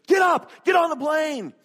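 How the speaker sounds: high, full-volume frantic holler; yelling. Retold or imitated. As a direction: yell at your wife to hurry, hammed up. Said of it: "he's yelling at his wife".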